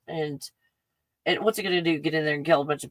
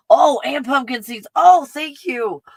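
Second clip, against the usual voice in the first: gasping, higher and louder with glee; excited gasp; 8 semitones higher, 8 dB louder than usual. Real, not acted. Vlogger unpacks a viewer's gift, gasping higher with joy. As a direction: squeal over each new treat. Gasps over gifted snacks.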